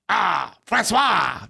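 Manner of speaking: very drawn-out put-on French accent; mock French accent. Mocking kids who took French, exaggerated French accent. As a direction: stretch it out in a hammed-up French accent. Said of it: "They never were like".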